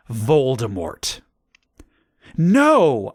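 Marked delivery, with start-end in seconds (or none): 2.4-3.1 high, loud cry of alarm; scared shout. A narrator's reading. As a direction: shout it out in fright. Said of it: "I shouted in a scared voice".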